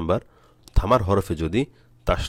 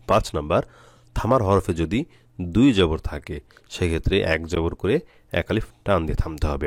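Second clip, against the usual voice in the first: ordinary delivery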